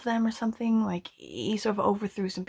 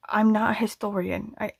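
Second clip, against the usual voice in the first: ordinary talk, nothing marked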